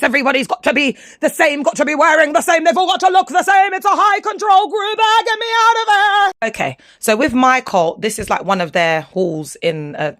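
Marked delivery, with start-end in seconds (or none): 0.0-6.5 building from plain complaint to a high, loud "get me out of there"; rising exasperation. Real, not acted. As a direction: pitch climbing higher and louder to a near-squeal. Growing disgust at cult uniformity.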